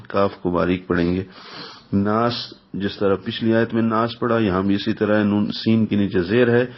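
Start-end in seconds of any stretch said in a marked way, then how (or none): none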